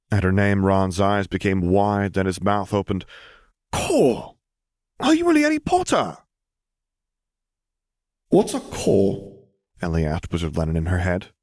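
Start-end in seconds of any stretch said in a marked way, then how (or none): 3.7-6.2 high, awed excited shout; amazed boy. A narrator's reading. Ron amazed. 8.3-9.4 slow, high puzzled question; childlike confusion; puzzled girl. A narrator's reading. Ellie to Lennon in head.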